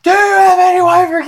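high, loud repeat of the question; insistent shout. Real, not acted. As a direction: shout it over the crosstalk. Repeats question over the others.

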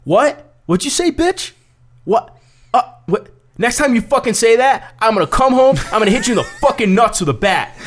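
high-pitched, hammed-up threats; joking mock aggression. Skinhead parodying the angry caller for his friend, high mock rage. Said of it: "he's making a joke to me".

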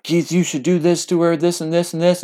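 mock nagging in a high register; nagging mom. Retelling mom's nagging at dad.

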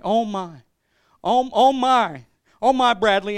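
loud, rising mock astonishment; imitated overwhelmed mom. Son acting out mom counting the money, building astonished cry. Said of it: "my mom's crying, and she's bawling".

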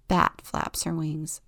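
plain reading voice

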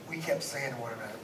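ordinary talk, nothing marked

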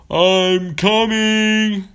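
high, loud, drawn-out holler; calling out. Acted. Pirate calling to the whale before jumping, long holler. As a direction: sing it out across the water.

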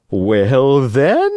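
high, sly fox voice. Acted. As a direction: coax him like a cunning fox. Fox speaks in story.

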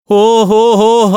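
long, drawn-out high wailing oh-ho-ho; a crazed, slow howl before fainting; wild wail. A narrator's reading. Landlady seeing the pickle-covered hand, letting out a wild slow wail. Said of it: "ఓ వెర్రికేక వేసి".

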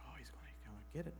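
quick, hushed mock whisper; breathy rushed whisper mocking karma fears. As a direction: whisper it like a silly superstition.

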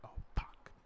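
breathless whispered curse under his breath; whispered panic. Retold or imitated. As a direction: swear silently so he can't hear.

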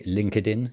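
"LinkedIn" with an extra vowel, link-ed-in; Spanish-style LinkedIn. Shown as a demonstration. Host asks guest how Spanish speakers say it, Spanish-style "LinkedIn". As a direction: say "LinkedIn" in three syllables, link-ed-een. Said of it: "Do you also say".

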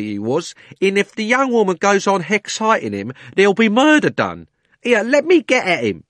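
high, loud dropped-aitch cockney; cockney cabby. A narrator's reading. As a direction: insist like a cabman. Cabby insists about the horse.

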